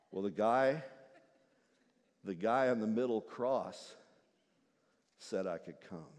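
very low, soft answer; humble thief. Acted. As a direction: say it simply.

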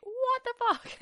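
high, loud shocked yell; shocked outburst. Retold or imitated. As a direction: blurt it out in disbelief. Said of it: "she was like".

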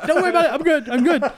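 high, frantic fake reassurance; mock panic. Friend who tripped while hurrying, high mock-panicked reassurance. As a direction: imitate a panicked friend insisting he's fine. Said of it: "he was so panicked".

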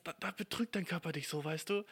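ordinary delivery

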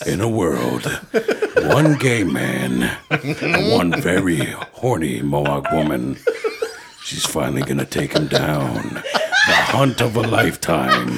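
low, slow dramatic trailer voice; movie trailer voice. Shown as a demonstration. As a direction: epic movie trailer announcer. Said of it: "I'd have to do the movie trailer voice".

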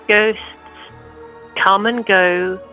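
ordinary delivery

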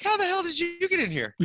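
very high-pitched disbelieving squawk; retold shock at finding friends inside; incredulous. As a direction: demand it in hammed-up amazement. Amazed to find friends inside.

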